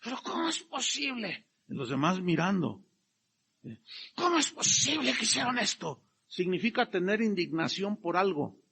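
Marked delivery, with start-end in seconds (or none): 0.0-1.5 higher, quiet huffing "¿Pero cómo es posible?"; mock scandalised muttering; mock indignation. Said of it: "estaban así". 4.2-6.0 higher, scandalised "¿Cómo es posible?"; lightly voiced indignant disciple; mock indignation. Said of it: "bufar con cólera".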